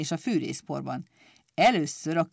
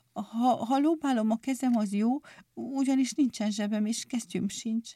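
a touch of fright, slightly higher; frightened question; 4 semitones higher, 4 dB quieter than usual. A narrator's reading. Pic asking the clippers, a touch frightened. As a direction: ask nervously, a little hushed. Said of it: "Ijedten kérdezte".

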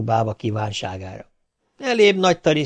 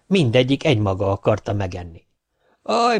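ordinary delivery

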